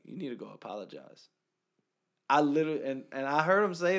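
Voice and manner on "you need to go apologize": quiet, gentle; soft voice of God. Retold or imitated. Retelling how God spoke to him, softly and gently. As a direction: say it softly like a calm correction. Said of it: "God said so softly".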